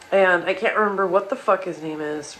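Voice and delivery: ordinary delivery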